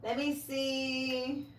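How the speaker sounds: very slow, soft let me see; soft, slow thinking aloud; slow drawn-out musing. Real, not acted. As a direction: stretch it out while choosing. Drawn-out while picking a card.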